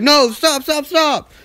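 high, loud urgent protest; frantic mom. Retold or imitated. Mom shouting at the doctors to stop. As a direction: cry out to stop them.

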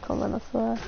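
ordinary talk

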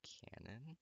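breathy whispered question; hushed disbelieving whisper. Real, not acted. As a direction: whisper it like a secret. Whispered aside reading fanfic.

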